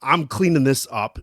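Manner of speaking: ordinary talk, nothing marked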